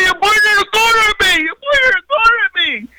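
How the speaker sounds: very high, mush-mouthed mock wail; high-pitched, unintelligible retold outburst; garbled protest. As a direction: yell it back, words smeared and squealed. Acted garbled protest to deputy.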